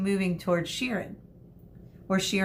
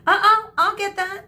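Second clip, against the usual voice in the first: put-on eager high girl voice; 13 semitones higher, 7 dB louder than usual. Medusa eager to grab bag.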